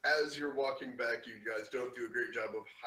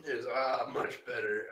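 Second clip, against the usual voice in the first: ordinary delivery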